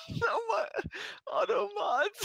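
high, squealing laugh through words. Real, not acted. Laughing at the misquote.

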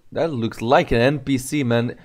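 ordinary talk

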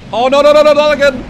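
high loud panicked no; panicked shout. Real, not acted. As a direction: yell no in alarm. Dying again in game.

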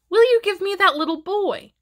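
very high little bird voice; sparrow character. A narrator's reading. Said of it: "asked the sparrow".

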